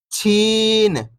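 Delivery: long, stretched "छीन"; "छीन" with a sustained long ई; drawn-out vowel. Shown as a demonstration. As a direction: hold the ee in "छीन". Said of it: "खींच के पढ़ेंगे".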